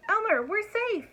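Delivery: high, loud, joyful yell; yelling with relief. A narrator's reading. Dragon yelling to Elmer after landing on sand, high and joyful. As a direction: shout the good news. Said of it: "yelled the dragon".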